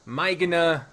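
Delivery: "Migna" tried as MY-g-nuh; trying out pronunciation. Shown as a demonstration. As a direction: test "Migna" with first syllable like "my". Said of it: "forgot what the proper pronunciation was".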